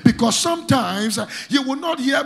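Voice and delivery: loud, rhythmic pulpit declaration; preaching at full force. Acted. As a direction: hammer each phrase at the congregation. Preaching against the devil.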